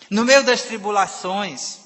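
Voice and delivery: ordinary delivery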